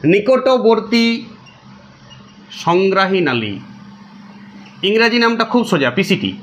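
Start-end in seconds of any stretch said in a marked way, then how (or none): 0.0-3.5 drawn out very slowly, syllable by syllable; slow dictation. Shown as a demonstration. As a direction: spell out নিকটবর্তী slowly so students can write it. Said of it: "তার গোড়ায় কী আছে?".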